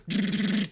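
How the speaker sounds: high trilled burst of gunfire; imitated machine-gun fire. Shooter fires the Uzi at the kid, imitated machine-gun rattle. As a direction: make a rapid rattling Uzi noise.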